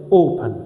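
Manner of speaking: open, first syllable long and strong, second light; stress on O in open. Shown as a demonstration. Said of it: "My stress is on the O".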